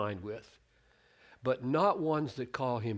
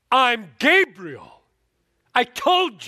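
booming slow, much louder and higher; angry angel; 10 semitones higher, 13 dB louder, slower than usual. Retold or imitated. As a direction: thunder it out as an offended angel. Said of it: "that's my tone of voice".

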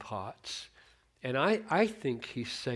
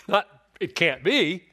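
scoffing disbelief; 4 semitones higher, 9 dB louder than usual. Shown as a demonstration. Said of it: "she really means how. Not".

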